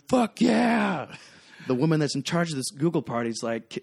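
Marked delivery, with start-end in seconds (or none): 0.1-1.1 high, loud partying yell; mock scream. Bartender imitating a girl on the bar, mock scream. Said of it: "This girl's on top of the bar screaming".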